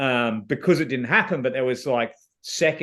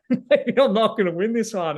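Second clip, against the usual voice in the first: laughing; 7 semitones higher than usual. Real, not acted. Realises he is losing the challenge.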